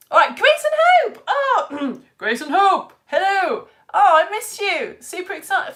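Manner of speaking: high, loud, squealing hello; excited greeting. Real, not acted. Teacher greeting pupils she missed, excited squeal. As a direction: greet them with gushing delight.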